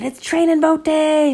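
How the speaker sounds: put-on excited kids. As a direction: shout it happily together. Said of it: "And they said".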